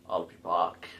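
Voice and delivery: deliberately bad low Austrian-accent impression; Arnold Schwarzenegger accent. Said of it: "doing the Arnold impression".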